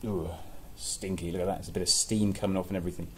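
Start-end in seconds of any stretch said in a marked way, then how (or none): none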